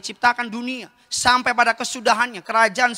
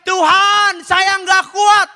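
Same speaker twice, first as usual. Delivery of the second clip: high, drawn-out cry to God; anguished cry; 9 semitones higher, 6 dB louder, much slower than usual. Acted. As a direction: cry out slowly as if at breaking point. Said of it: "kau teriak". (teriakan putus asa kepada Tuhan)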